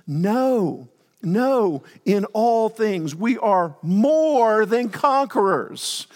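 loud, slow declaration of victory; rising preacher's call; preaching at full force. Acted. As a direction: proclaim it like a triumph. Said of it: "preaching scripture".

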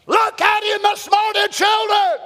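high, ringing preacher's shout; sustained high preaching cry; exhorting shout. Real, not acted. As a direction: call out to the whole congregation, high and strong. Preacher calling out to congregation.

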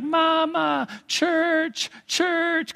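put-on high, whiny toddler pleading for mama; a small child's high crying plea. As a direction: imitate a two-year-old wailing for church. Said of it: "he started crying".

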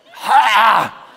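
loud, high mock wail; exasperated cry. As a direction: burst out in comic frustration. Exasperated cry at being forgiven first.

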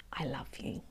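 whispered prayer. Acted. Ending the sermon by telling God, a hushed whisper. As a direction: barely breathe the words to God.

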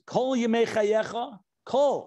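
Kol drawn out and pitched high; emphasis on Kol. Shown as a demonstration. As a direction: lean hard on the word Kol, then repeat it alone. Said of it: "the emphasis on the word Kol".

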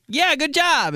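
high, loud chirpy praise; mock cheery praise. Imitating someone offering a high five, high chirpy praise. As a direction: sing it out like an over-eager teammate. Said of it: "When somebody's like".

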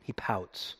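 breathy whispered repeat; a soft, knowing whisper. Real, not acted. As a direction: drop to a hushed whisper for effect.

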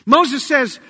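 ordinary talk

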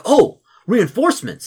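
high, loud, drawn-out delight; delighted paladin. Acted. Paladin greeting reinforcements at the outpost, delighted. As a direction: exclaim it like help has finally come.

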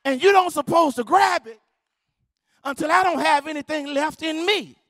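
high, forceful protest; indignant lifter. Retold or imitated. Lifter telling off the spotter for grabbing too soon, indignant. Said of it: "jump off the bench and almost fight".

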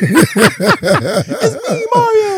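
high, laughing Italian cartoon voice; sing-out mock Italian accent, loud and high; Mario. Mocking the immigrant who sounds like Mario, cartoon Italian accent. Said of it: "you sound a lot like Mario".

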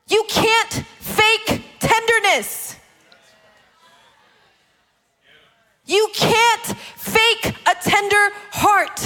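high, sustained, impassioned preacher's cry; full-force preaching. Real, not acted. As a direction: cry it out to the congregation, drawing out key words.

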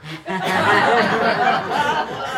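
a real high burst of laughter; sudden high laugh; amused laugh. Real, not acted. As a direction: laugh at the absurd memory. Laughing at own car story.

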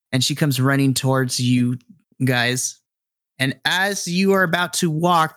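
usual speaking voice

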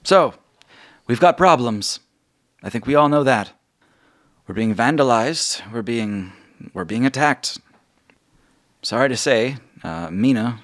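rally the room with grave news; Margot addressing the guild. Acted. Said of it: "Margot stands and says".

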